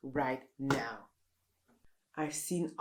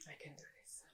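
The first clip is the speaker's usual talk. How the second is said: whispered pep talk; 24 dB quieter, much faster than usual. Acted. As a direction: whisper "I can do this" to yourself.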